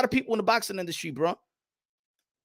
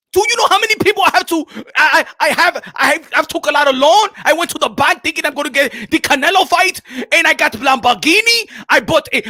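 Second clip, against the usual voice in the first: desperate African boxer, high and loud; hammed-up indignation, pleading to be understood; Makabu; 9 semitones higher, 10 dB louder than usual. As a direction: overwrought rant in African accent. Makabu desperate rant to Punch.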